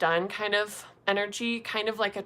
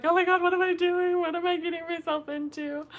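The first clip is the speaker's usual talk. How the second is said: high fast panicked voice; freaking out about my own choices; 10 semitones higher, faster than usual. Retold or imitated. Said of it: "I'm just like".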